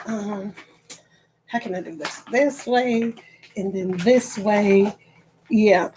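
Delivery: normal talking voice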